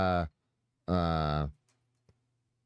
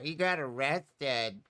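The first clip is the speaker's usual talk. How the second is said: mocking quote; 4 semitones higher, 3 dB quieter, much slower than usual. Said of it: "morons come in here saying".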